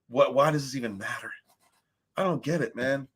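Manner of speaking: ordinary delivery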